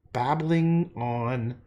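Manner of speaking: ordinary delivery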